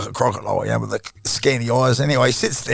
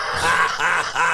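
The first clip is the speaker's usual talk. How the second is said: laugh; 3 semitones higher than usual. Real, not acted. Laughing at dad's phone call.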